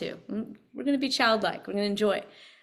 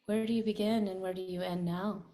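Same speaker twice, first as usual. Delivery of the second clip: guided meditation; 2 semitones lower, 6 dB quieter than usual. Acted. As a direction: guide them inward, softly. Leads a meditation.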